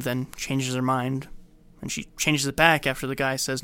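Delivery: ordinary talk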